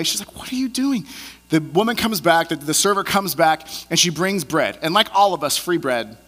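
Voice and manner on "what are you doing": high, fairly soft incredulous question; mock disbelief. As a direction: imitate a baffled date, pitch lifted. Date questions his tipping scheme.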